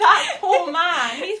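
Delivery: slow, laughing mock sympathy; laughing pity. Real, not acted. Mock pity for the squashed stretchy toy, laughing. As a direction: drag it out through a laugh.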